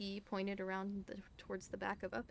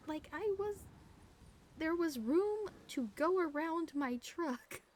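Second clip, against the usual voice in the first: indignant rant; 8 semitones higher, 5 dB louder than usual. Real, not acted. Indignant rant about officer.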